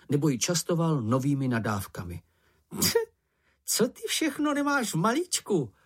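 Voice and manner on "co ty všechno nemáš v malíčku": very high, amazed squeal; admiring call. A narrator's reading. Čenich marvelling at Mumínek, high admiring call. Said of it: "volal Čenich s obdivem".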